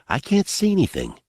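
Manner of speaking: put-on boy's voice. A narrator's reading. Boy peering into the dark, high boyish voice. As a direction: voice a boy, pitched well up.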